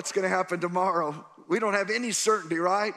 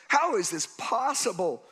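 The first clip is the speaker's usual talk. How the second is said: rising, higher panicked questions building to a shout; frantic Joseph; 6 semitones higher than usual. Acted. Preacher voicing Joseph's fears to the crowd, frantic shout. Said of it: "He's so frantic".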